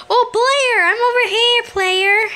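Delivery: high, loud teasing call; mock calling out. Player jokingly calling another player over, high and loud.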